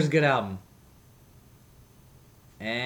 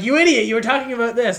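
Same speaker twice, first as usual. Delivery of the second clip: mocking listener; 9 semitones higher, 8 dB louder, faster than usual. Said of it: "They'll be like".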